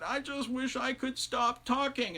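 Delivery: high, slow, weary wail; drawn-out high plea, a touch ragged; ragged wife. A narrator's reading. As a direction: wail it out, worn from endless talking. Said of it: "her voice was so ragged".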